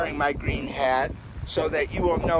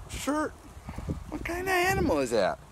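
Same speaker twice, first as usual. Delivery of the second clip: put-on high teasing kids-show voice; bright high playful question; high excited; 13 semitones higher than usual. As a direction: pitch way up like talking to a small child. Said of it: "kids show voice".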